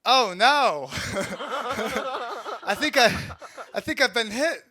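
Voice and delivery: high, loud, laughing Walken. Retold or imitated. Medic just hit by rocket, high Walken cry. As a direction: hit by the blast, still doing Walken. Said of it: "Doc was like".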